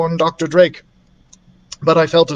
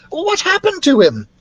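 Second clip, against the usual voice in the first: put-on higher, girlish worried question; 14 semitones higher, 3 dB louder than usual. Beatrice asks what happened to Daniel, higher anxious girl voice.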